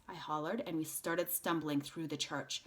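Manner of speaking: ordinary delivery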